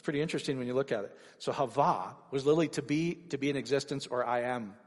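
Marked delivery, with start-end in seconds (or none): none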